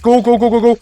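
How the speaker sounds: ordinary talk, nothing marked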